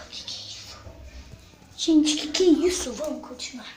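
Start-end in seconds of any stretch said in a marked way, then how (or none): 0.1-0.8 unvoiced scared whisper; frightened whisper. Real, not acted. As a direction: whisper it in fear.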